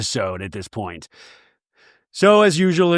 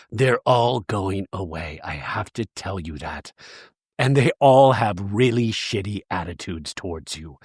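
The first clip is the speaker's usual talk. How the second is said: ominous psychic impression; 8 semitones lower than usual. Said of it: "it was like".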